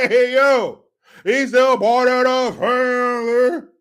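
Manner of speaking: loud, high, drawn-out mock politician; Barry Obama. Host urges politician to come out like this, hammed-up impression. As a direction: stretch it out in a hammed-up street-talk impression. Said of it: "Why don't you come out there?".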